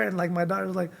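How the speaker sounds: ordinary talk, nothing marked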